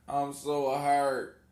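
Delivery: slow, drawn-out mock lament; hammed-up slow sorrow; mock hurt. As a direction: wallow theatrically in fake hurt. Mock lament over crashed computer.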